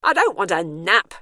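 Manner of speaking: loud, defiant yell. A narrator's reading. Bernice answers the nap announcement, yelling. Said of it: "she yelled".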